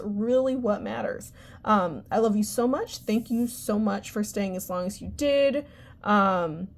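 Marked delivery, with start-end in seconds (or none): none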